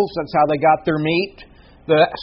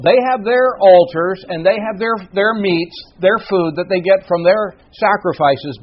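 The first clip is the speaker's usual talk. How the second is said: usual speaking voice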